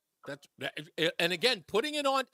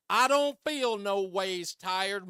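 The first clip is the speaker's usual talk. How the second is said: mock Hillary drawl; much slower than usual. Mocking Hillary's campaign drawl.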